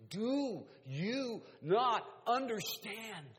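each word slow, loud and high; slow, forceful preacher's plea; emphatic exhortation. Acted. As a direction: press every word home hard. Acted exhortation pushed at a listener.

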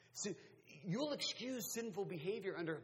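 usual speaking voice

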